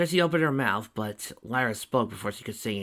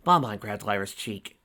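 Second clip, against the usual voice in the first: ordinary delivery, nothing marked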